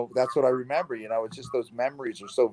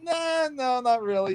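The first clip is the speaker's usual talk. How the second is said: sheepish denial; 9 semitones higher, 4 dB quieter, slower than usual. Retold or imitated. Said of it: "And I'm like".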